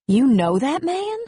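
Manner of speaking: astonished query; surprised question. Acted. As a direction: ask it incredulously, ending high. Dixie asks in surprise.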